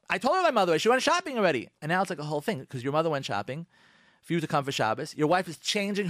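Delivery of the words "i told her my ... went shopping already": higher, louder protesting husband; mock indignant husband; voicing the husband. Husband protesting to his wife, higher and louder.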